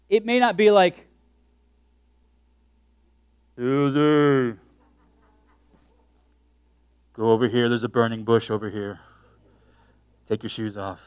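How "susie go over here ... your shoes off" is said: low, booming divine voice; voice of God. Acted. God calling Susie to the burning bush, low divine voice. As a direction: call down from the burning bush, lower.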